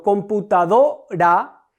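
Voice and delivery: slow "computadora", leaning on the syllable "do"; stress on DO. Shown as a demonstration. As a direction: stretch out "computadora" and load the voice onto "do". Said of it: "en la sílaba do recargo la voz". ("computadora", despacio y recargando la voz en "do")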